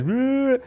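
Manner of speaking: high mock wail; brief high-pitched bawl, imitating a crying child; imitated child's cry. Speaker imitating a little boy who banged his head, mock crying. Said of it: "he starts to cry".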